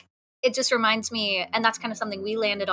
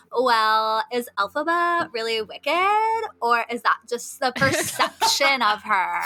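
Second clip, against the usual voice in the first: put-on Valley Girl voice; 2 semitones higher, 4 dB louder, slower than usual. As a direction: lightly imitate a Valley Girl questioning. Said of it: "she had the best Valley Girl voice".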